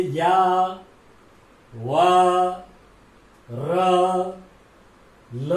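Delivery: या, वा, र, ल each drawn out on a held, level note; या, वा, र, ल intoned slowly, a pause after each; pronunciation demo. Shown as a demonstration. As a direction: sound out या, वा, र, ल one by one with long, sustained vowels. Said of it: "यहां अंतःकरण से हमारा उच्चारण होता है".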